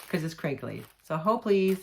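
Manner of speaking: normal talking voice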